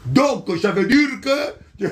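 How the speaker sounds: put-on African accent. Preacher mimics himself explaining at the board, African accent. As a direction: replay the answer with a hammed-up African accent. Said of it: "avec mon accent africain".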